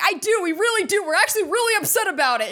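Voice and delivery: high, fast whiny mock voice; whiny mock protester. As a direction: mimic the offended crowd, whining. Mocking the upset public.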